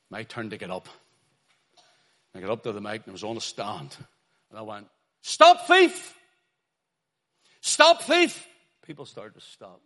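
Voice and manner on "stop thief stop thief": full-force high-pitched street shout; loud, high open-air cry. Retold or imitated. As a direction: bellow it to halt a whole crowd. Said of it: "I just kept shouting".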